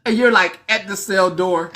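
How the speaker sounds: usual speaking voice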